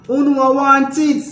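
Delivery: animated example. Shown as a demonstration. Teaching example, pitched up.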